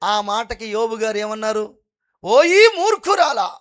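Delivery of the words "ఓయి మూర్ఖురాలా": higher, louder, slow stern rebuke; Job's rebuke. Retold or imitated. Job rebuking his foolish wife, slow stern rebuke. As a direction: draw it out as a grave reproach. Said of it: "అన్నాడండి".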